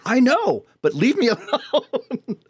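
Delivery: high-pitched, laughing plea to be left alone; laughing exasperation. Retold or imitated. Friend retelling being pestered at the door, high laughing exasperation. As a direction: beg them off while cracking up.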